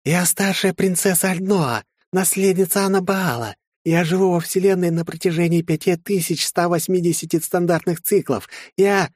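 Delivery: high, proud indignant lizard princess. A narrator's reading. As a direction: declare rank, offended. Offended princess declares.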